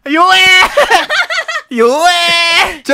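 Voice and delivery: mocking. Real, not acted. Teasing weak teammate, laughing.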